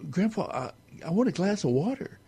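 put-on high, quick child's plea; little boy asking. The boy asks grandpa again, high and pleading. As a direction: beg for water like a small boy. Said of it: "And he says".

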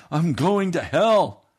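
despairing cry. Retold or imitated. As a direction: drag out the despair. Man cries in despair.

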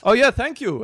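put-on high, loud Italian-accented old man. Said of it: "got an Italian accent".

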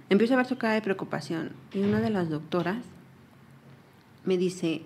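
ordinary delivery

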